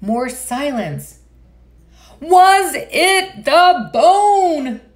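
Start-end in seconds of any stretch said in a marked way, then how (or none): 2.2-4.8 very slow, high, loud drawn-out holler. Acted. Rocket hollering up to the silent bird, slow loud holler. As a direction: stretch it out in a big holler. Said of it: "Rocket hollered".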